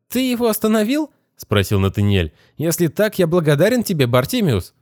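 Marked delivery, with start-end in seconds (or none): 0.1-1.1 put-on high, weak voice of a dazed boy; groggy young boy, just woken; boy's voice. A narrator's reading. 2.6-4.7 put-on high, stiffly grateful young boy; boy thanking the genie. A narrator's reading.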